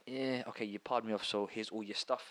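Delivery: low, very soft and resigned; mature restraint. Acted. Imagining a calm exit from a cheating girlfriend, mature restraint. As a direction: keep the breakup hushed and composed. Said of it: "I've also got maturity".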